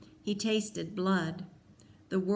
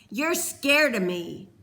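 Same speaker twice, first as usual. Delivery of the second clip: slow, louder jeering taunt; 5 semitones higher, 7 dB louder than usual. A narrator's reading. Peter jeering at Cole mid-beating, slow loud taunt. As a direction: lean on scared, mocking. Peter bullying during fight.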